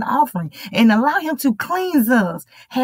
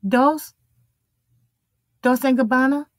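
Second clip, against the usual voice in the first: waiting-room name call; 3 semitones higher than usual. Acted. Doctor's office calling the next patient in, questioning call. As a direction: call out the name with a rising lilt. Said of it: "they come and they open the door".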